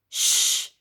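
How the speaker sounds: hard unvoiced shh; nanny's loud shush. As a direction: shush him firmly. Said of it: "she shushed".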